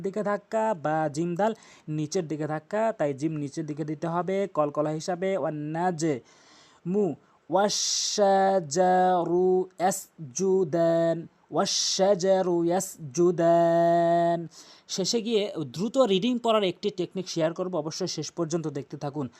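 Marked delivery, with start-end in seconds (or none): 5.4-14.5 slow, drawn-out chanted recitation; Quran recitation. Shown as a demonstration. Tajweed teacher reciting the verse for students, slow and melodic. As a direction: recite it melodically, stretching every vowel.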